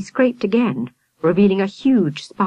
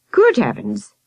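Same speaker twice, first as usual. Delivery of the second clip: startled exclamation; 8 semitones higher, 3 dB louder than usual. A narrator's reading. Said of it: "exclaimed Sergeant Patterson".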